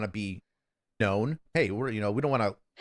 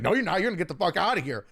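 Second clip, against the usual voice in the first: quick, higher-pitched mock refusal; mock yelling neighbor; 6 semitones higher, faster than usual. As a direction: lightly imitate an angry man's order. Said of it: "he's yelling at us".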